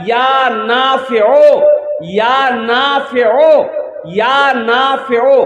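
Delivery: each phrase drawn out slowly; slow demo of the wird; slow recitation demo. Shown as a demonstration. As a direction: recite it slowly for the crowd to repeat. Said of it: "कि आछे बलो?".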